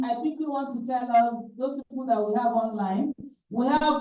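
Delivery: ordinary delivery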